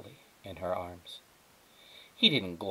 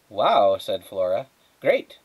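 excited; 8 dB louder, slower than usual. Acted. Flora excited, squirrel responds.

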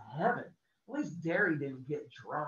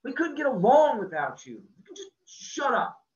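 loud, higher, gruff affection; fierce loyalty, insisting; 10 semitones higher, 9 dB louder than usual. A narrator's reading. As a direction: friend fiercely reassuring the hurt boy. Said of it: "Two-Bit said fiercely".

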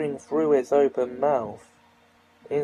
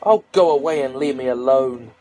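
put-on louder, growling sick boy; 5 dB louder than usual. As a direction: snarl at her to leave. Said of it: "growled Eustace".

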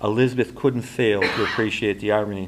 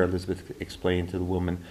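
ordinary delivery, nothing marked

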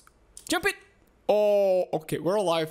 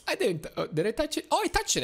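usual speaking voice

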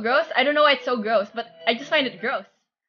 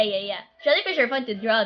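ordinary talk

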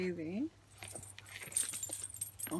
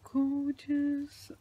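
ordinary talk

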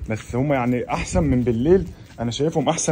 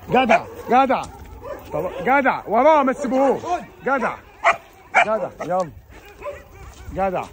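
egging the dogs on; 7 semitones higher, slower than usual. Real, not acted. Man egging his dogs to chase, high excited calls.

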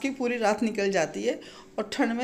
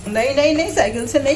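firm no; 5 semitones higher, 10 dB louder than usual. Real, not acted. Stopping child taking cycle. (बच्चे को cycle से रोकना, ऊँची आवाज़ में मना करना)

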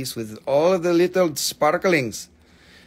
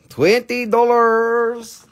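high, loud, slow holler held on the last word; drawn-out price call; 9 semitones higher, 6 dB louder, much slower than usual. Pretend. Seller calls twenty-dollar price to viewers, loud drawn-out holler. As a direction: sing out the price, stretched long.

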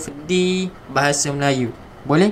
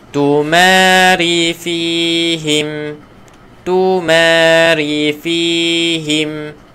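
"Tumari fihim" slow, drawn-out chant; slow recitation; 4 dB louder, much slower than usual. Shown as a demonstration. Teacher modelling the last line for the class, slow chant.

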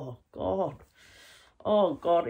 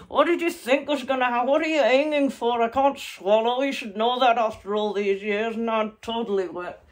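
loud scolding; 5 semitones higher, 7 dB louder than usual. Real, not acted. Soaked, scolding partner loudly.

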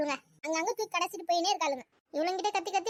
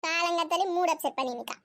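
usual speaking voice

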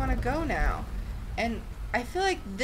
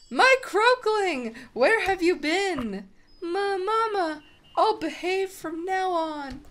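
put-on higher, louder mother and child voices; tender reunion; 8 semitones higher, 4 dB louder than usual. Toad mother meets her lost croakling, overjoyed. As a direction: play the happy reunion.

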